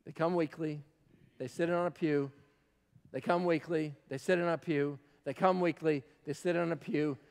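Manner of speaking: low, soft, measured litany; hushed, sombre. Real, not acted. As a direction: lay it out softly and gravely. Quiet sombre listing.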